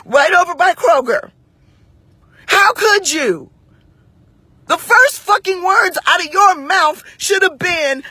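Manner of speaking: high, loud-edged outrage; incensed, pitch held way up; indignant scolding. Retold or imitated. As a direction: relive berating the staff. Reliving her confrontation, still furious.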